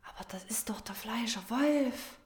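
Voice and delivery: fassungslos geflüstert; flüstere ungläubig beim Erkennen; erstauntes Flüstern. Acted. Erkennt Dieb auf Video. (leises, verblüfftes Flüstern)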